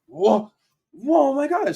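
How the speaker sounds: a touch of happy-dance delight; excited godmom. Retold or imitated. Godmom hearing about the Nike campaign, excited and high.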